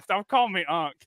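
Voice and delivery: ordinary talk, nothing marked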